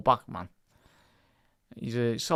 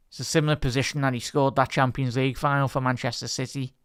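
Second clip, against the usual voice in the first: normal talking voice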